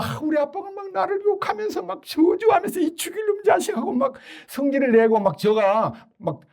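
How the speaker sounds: a hint of a wounded kid; lightly voiced child listing grievances; hurt child. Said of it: "그러면 그 아이가요".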